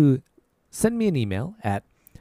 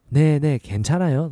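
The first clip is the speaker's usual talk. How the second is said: quick reassurance; much faster than usual. Retold or imitated. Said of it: "Or we've got the question".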